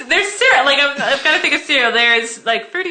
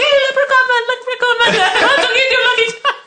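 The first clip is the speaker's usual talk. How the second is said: put-on high, excited kid voices chasing the leprechaun; much higher playful children's voices; children calling out; 10 semitones higher than usual. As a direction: call out like eager little kids. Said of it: "They're like".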